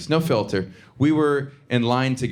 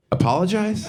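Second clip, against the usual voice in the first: higher, incredulous rising mom voice; mom's voice; 7 semitones higher than usual. Retold or imitated. Mom in the car scoffing at her son, incredulous. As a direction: toss it back like the idea is absurd. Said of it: "And she said".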